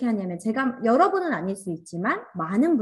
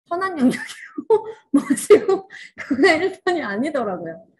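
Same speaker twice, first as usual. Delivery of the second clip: talking through laughter, louder and higher; laughing; 5 semitones higher, 6 dB louder than usual. Real, not acted. Teacher telling what she really found out, laughing. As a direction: laugh while getting the words out. Laughs while revealing the surprise finding.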